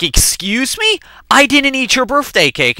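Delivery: high-pitched, affronted protest; acted-out offended comeback; indignant denial. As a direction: protest as if falsely accused.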